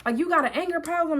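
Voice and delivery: high-pitched, heated confrontation; retold angry outburst; mad, arguing. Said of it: "So now I'm mad".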